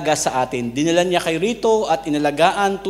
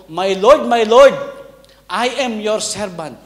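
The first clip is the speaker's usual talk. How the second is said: high and loud, "Lord" rising, slowing at the end; loud mock servant greeting; 6 semitones higher, 9 dB louder than usual. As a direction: belt out the humble greeting as an order. American dictating required greeting. (malakas na kunwaring pagbati ng alipin)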